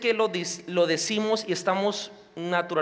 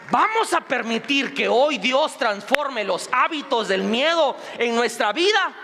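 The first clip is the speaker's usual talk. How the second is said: rally the congregation at full force; rousing exhortation; 5 semitones higher, 4 dB louder than usual. Real, not acted. Preacher urges the congregation at full force.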